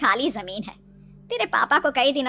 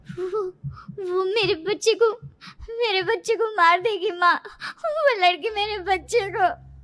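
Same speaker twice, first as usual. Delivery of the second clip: crying, broken pleading; a dazed daughter crying to her mother; tearful panic; 5 semitones higher than usual. A narrator's reading. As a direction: sob it out, still caught in the dream. Half-awake after nightmare, crying.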